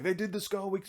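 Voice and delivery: high-pitched imitation of fans; mock high fan voice; excited fans quoted. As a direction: voice the crowd up high. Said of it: "people are like".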